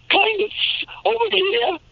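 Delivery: very high, wacky character voice; Ed Wynn. Shown as a demonstration. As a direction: pitch it way up, cartoon-comedian style. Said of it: "I did an Ed Wynn kind of".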